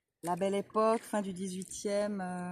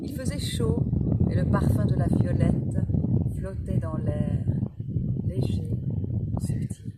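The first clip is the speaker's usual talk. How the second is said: measured Proust reading, pausing between phrases; poised recital with spaced-out words; literary reading aloud; 7 dB louder than usual. A narrator's reading. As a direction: read it out phrase by phrase, savouring each. Reads a Proust extract aloud.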